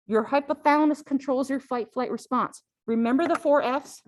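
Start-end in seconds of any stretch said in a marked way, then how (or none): none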